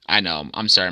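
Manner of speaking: usual speaking voice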